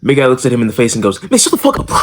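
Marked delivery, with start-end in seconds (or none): 1.2-1.9 high, mocking shut up; mock tough retort. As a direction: fire back a dismissive insult. Tall guy retorts to the kid.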